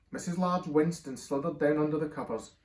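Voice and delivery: ordinary delivery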